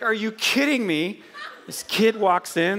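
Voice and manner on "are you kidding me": high, laughing "are you kidding me"; mock outrage through a laugh; laughing disbelief. Recalling the young doctor, laughing disbelief. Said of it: "I seriously was like".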